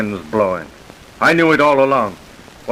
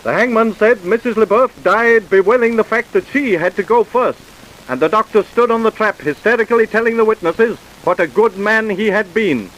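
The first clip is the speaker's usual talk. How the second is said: higher, formal storytelling announcer voice; radio narrator; 10 semitones higher, 4 dB louder than usual. A narrator's reading. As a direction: narrate the aftermath crisply and gravely.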